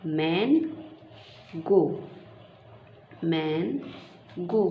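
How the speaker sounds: मैन, pause, गो, said as two parts; syllable-by-syllable demo for a child; split into syllables. Shown as a demonstration. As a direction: chop मैंगो into मैन and गो with a pause. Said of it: "दो parts में divide कर दीजिए".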